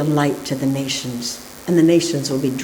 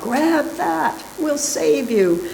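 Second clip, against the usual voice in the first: much higher call, slowing on save you; 11 semitones higher, slower than usual. A narrator's reading. Helicopter crew calling down to the man, high slow call. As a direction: shout down from the helicopter. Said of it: "they call out".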